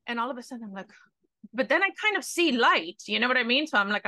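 ordinary delivery

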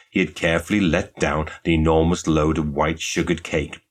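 usual speaking voice

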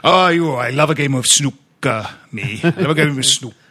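Welsh accent with long gaps between syllables; loud Welsh lilt, syllables stretched apart. Shown as a demonstration. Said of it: "if you extend the gap between two syllables of a word".

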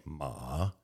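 "ma" dipping down, then up; "ma" in a Mandarin dipping tone; dipping third tone. Shown as a demonstration. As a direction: let "ma" sag low and lift. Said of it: "The third tone is dipping".

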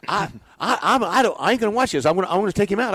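high, loud, stammering outburst; agitated rant. Retold or imitated. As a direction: sputter like a riled-up manager. Manager ranting in dugout.

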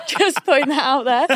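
loud, amused aside through laughter; giggly, real laughter in the words; laughing aside. Real, not acted. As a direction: say it while cracking up. Laughing over her own toilet admission.

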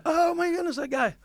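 high amazed gasp of recognition; stunned exclamation. Retold or imitated. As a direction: sound floored, pitch way up. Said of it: "I'm sitting there going".

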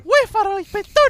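high, loud schoolboy punchline; Pepito punchline. Retold or imitated. [name] delivering Pepito's punchline to the inspector, high and loud. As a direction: belt the kid's answer up high. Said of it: "dice Pepito".

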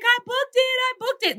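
very high, overjoyed mock scream; screaming with joy. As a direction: shout the good news, nearly in tears. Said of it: "I'm just, like, screaming and crying".

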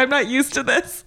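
high, tearful squeal through laughter; imitated weepy high voice; mock sobbing. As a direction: wail it like a comic crybaby. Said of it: "And then I start sobbing".

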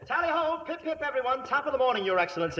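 high, chirpy upper-class British greeting; hammed-up posh English. Fake earl hailing the hunt, hammed-up posh British. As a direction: play the silly English lord.

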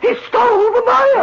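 outraged cry. Acted. Designer repeats the theft to his partner, rising to a loud outraged cry.

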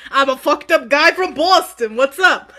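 loud, hammed-up Boston swagger; mock Boston tough guy. Doing a Boston guy's intro to Din, loud and laughing. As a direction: shout it like a rowdy Boston guy. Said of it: "May felt is like".

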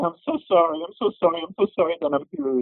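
put-on high, repeated childlike begging; pleading apology. Boy begging his father as he beats him, pleading. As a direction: plead it over and over, pitched high.

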